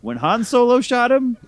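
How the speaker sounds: high, loud winking explanation; hammed-up helpful narrator voice; mock aside to camera. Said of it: "turns directly to the camera and goes".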